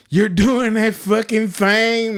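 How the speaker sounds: high, drawn-out giggly accusation; slow, high, on the edge of laughter; horse laughing. Retold or imitated. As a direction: crack up while calling someone out. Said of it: "I'm starting to start horse laughing".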